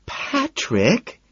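high-pitched delighted squeal; pleased squeal. A narrator's reading. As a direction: squeal the name, rising at the end. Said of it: "she squeals, pleased".

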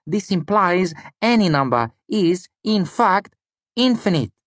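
put-on high voice in an odd Lanati accent. A narrator's reading. Student reasoning about infinity, odd Lanati accent. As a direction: explain it in a high, foreign-sounding Lanati accent. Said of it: "in his odd Lanati accent".

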